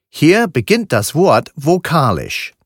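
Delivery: ordinary talk, nothing marked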